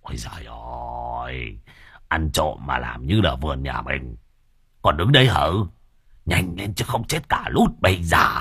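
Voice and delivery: giọng trầm, gắt gỏng càu nhàu; mắng kẻ chậm chạp cho nhanh lên; càu nhàu chửi. Acted. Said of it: "thì lại dẩu mỏ ra chửi". (lão Hậu gắt gỏng chửi đồng bọn)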